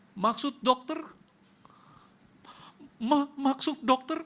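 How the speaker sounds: high, loud, stunned question; stammering in shock at the news; retelling a frightened plea to the doctor, stunned and loud; shocked disbelief. Retold or imitated.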